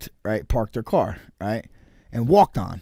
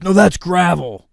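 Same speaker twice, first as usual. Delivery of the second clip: snappy ranger; 6 semitones higher, 6 dB louder, slower than usual. Retold or imitated.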